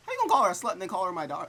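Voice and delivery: loud, fast indignant rant; incredulous outburst. Real, not acted. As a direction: call out the contradiction. Outraged at contradiction.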